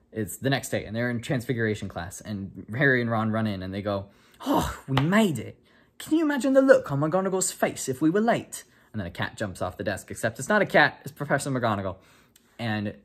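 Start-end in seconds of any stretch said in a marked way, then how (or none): 4.5-8.6 higher, louder, breathless relief; relieved schoolboy. Acted. Ron and Harry rush into class, relieved and loud. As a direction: gasp out your relief at making it.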